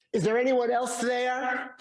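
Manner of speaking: high-pitched, mock call for help. Man on the cliff seeks another rescuer, calling out. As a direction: call upward as if to someone far away. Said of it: "and calls out".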